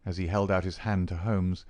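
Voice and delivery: plain reading voice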